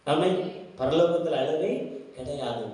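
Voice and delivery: ordinary talk, nothing marked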